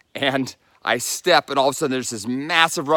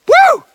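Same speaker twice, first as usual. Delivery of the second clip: very high, loud celebratory woo; whoop of joy; 13 dB louder than usual. Real, not acted. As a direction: let out a real cheer. Crosses into Connecticut.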